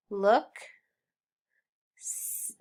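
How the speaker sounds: normal talking voice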